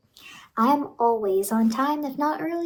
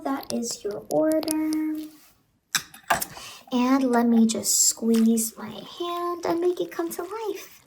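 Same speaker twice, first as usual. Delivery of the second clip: ordinary talk, nothing marked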